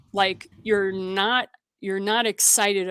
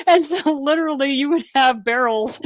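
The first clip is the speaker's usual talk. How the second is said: laughing; 5 semitones higher, 7 dB louder than usual. Real, not acted. Laughing while explaining the saying.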